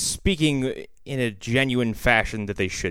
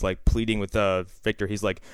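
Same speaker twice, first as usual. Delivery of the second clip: normal talking voice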